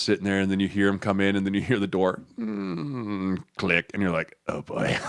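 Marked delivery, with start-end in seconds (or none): 4.5-5.0 whispered dread. Retold or imitated. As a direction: whisper it through a nervous laugh. Players hearing the door click.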